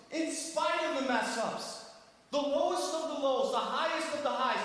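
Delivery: high, sustained exhortation; fervent preacher driving each point home; impassioned preaching. Acted. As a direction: admonish the congregation in a high preaching voice. Preaches at full force.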